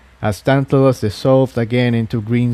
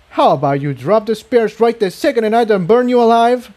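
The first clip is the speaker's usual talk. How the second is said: higher, cold threat; menacing threat; 10 semitones higher, faster than usual. Acted. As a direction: threaten them with calm menace. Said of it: "Incendia threatened menacingly".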